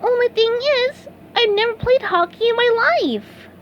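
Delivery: high sponge voice, owning up; a little sheepish confession; sheepish admission. A narrator's reading. SpongeBob admitting to Patrick, sheepish high voice. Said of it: "Then he admitted".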